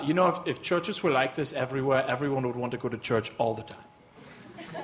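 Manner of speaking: low, badly faked German accent. German friend praising church after Sunday gathering, badly faked German accent. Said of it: "my bad German accent".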